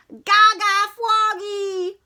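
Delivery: put-on slow, high babbling baby cheer; baby holler. Baby sister cheering Froggy, babbling holler. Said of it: "hollered Pollywogalina".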